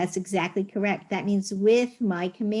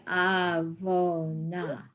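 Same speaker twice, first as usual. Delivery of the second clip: slow modelling; much slower than usual. Shown as a demonstration. Teacher repeating the student's answer slowly. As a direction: draw out avona syllable by syllable.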